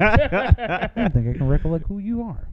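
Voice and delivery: stiff mock-formal voice, laughing; mock polite. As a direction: talk like a butler, half laughing. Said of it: "This is what I think you should do".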